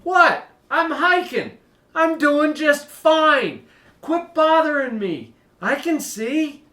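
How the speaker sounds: put-on high, loud exasperated teen voice; annoyed teen. Teen hiker answering worried parents, high exasperated whine. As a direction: brush off nagging parents, slow and put out. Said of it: "you're thinking".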